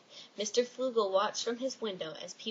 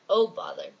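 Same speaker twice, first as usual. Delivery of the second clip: grumpy exclamation; 8 dB louder than usual. Acted.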